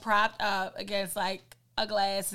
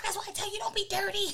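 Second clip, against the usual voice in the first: scolding; 10 semitones higher, faster than usual. Retold or imitated. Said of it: "What the fuck".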